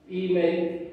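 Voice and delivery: usual speaking voice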